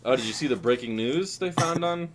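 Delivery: ordinary talk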